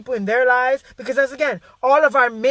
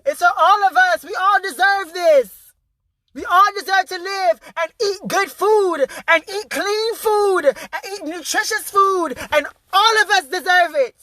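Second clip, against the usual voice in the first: impassioned plea; 5 semitones higher than usual. Real, not acted. Impassioned rant about food.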